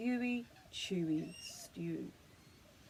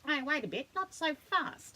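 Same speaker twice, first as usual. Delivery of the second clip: higher, a hint of a prickly echidna; lightly bristling echidna; 6 semitones higher than usual. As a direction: push back in a prickly way. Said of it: "he bristled".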